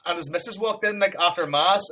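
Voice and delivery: ordinary delivery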